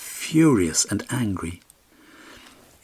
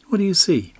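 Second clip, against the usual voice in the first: much higher, light pony voice; talking pony; 11 semitones higher, 3 dB louder than usual. A narrator's reading. Magic pony asking its rider, high and light.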